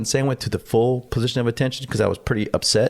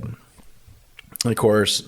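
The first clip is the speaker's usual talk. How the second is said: ordinary talk, nothing marked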